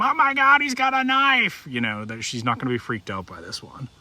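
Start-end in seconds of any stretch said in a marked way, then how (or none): 0.0-1.7 high-pitched mock scream of alarm; screaming lady. Startled neighbor lady reacting to a pocket knife, mock scream. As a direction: squeal it like a panicked lady, hammed up. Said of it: "that lady that's gonna scream".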